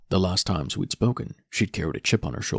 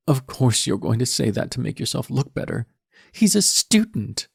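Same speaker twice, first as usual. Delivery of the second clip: put-on high, loud, scathing teen girl; scornful daughter; 5 semitones higher, 4 dB louder than usual. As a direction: scoff at his excuse. Daughter argues with father.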